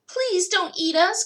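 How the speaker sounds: high, loud panicked plea; high loud terrified plea; frightened cry. A narrator's reading. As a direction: beg for your life. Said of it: "cried the dogs".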